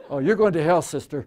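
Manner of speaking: loud, laughing hellfire tease; loud mock preacher's warning; mock damnation. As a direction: preach doom at her, hammed up. Teasing a little girl.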